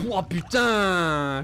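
startled drawn-out curse; real jump scare, slow gasp; scared horror game streamer. Real, not acted. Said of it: "je me suis chié dessus".

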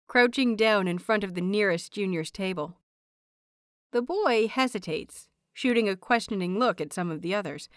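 usual speaking voice

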